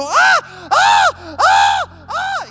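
put-on high, loud childish screams of pain, repeated; screaming like a child. Waking his wife at night, screaming like a child in pain. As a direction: howl in agony like a small boy. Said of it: "me puse a gritar como un niño".

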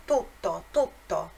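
tutto with a held, stronger double t. Shown as a demonstration. Teacher stressing the double consonant. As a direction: lean on the tt in tutto. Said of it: "os dois T's ... mais forte o som".

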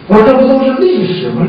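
usual speaking voice

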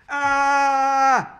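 long, high, very loud scream; shouted scream. A narrator's reading. Boy told of dentist's last patient, screaming. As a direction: scream "Aaah!" at full blast. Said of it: "I shouted".

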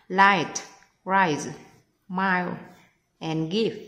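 each word read slowly and clearly; teacher reading the answer words aloud one by one. Shown as a demonstration. Teacher models the four words for students, said clearly. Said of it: "Còn câu hai là phát âm".

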